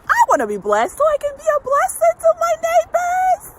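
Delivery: ecstatic outburst. Acted. Coach tells viewers she wants blessings, soaring, high and loud. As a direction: lift off like a revival preacher. Motivational coach peaks with joy.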